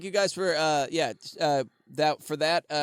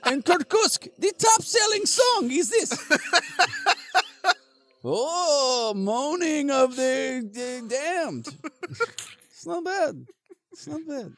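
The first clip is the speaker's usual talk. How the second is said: put-on Russian accent, boastful. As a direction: sell the album like a proud Siberian. Russian character promotes album.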